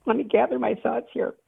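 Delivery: getting emotional. Real, not acted. Caller confiding to the radio host about prayer, getting emotional. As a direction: gather yourself through real emotion, pitched high. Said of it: "I'm getting emotional".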